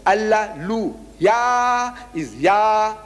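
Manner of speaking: Allah, Lu, Yah separated, with a long high Yah; syllables broken apart. Shown as a demonstration. Teacher breaks down Alleluia for listeners, drawn-out syllables. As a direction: stretch "Yah" out slowly as an exclamation. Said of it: "We begin with an exclamation".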